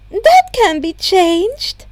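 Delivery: a lightly voiced principal, unhurried. A narrator's reading. Netsu voiced by narrator.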